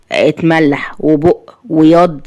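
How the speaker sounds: usual speaking voice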